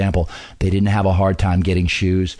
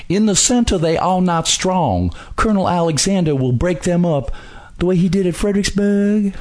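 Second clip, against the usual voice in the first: much higher, hammed-up dripping Southern accent; mock a way-too-thick Mississippi Southern accent; Robert E. Lee in thick Southern accent; 10 semitones higher than usual. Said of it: "Just kinda dripping and dripping with a really thick, way too thick Southern accent".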